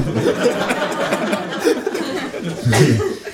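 high gleeful cackle; kangaroo cackle. A narrator's reading. Kangaroo laughs after line.

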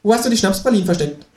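high, rapid kangaroo voice; kangaroo interrogating. A narrator's reading. Kangaroo demanding chocolates from narrator, high and fast. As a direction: fire the question as a talking kangaroo.

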